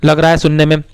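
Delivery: normal talking voice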